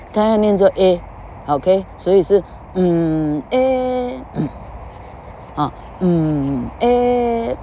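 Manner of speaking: A held long and slow; vowel A drawn out. Shown as a demonstration. Teacher models the vowel A for students, slow and stretched.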